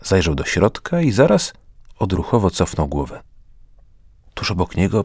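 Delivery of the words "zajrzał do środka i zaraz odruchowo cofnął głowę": usual speaking voice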